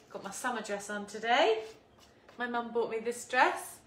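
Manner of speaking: ordinary talk, nothing marked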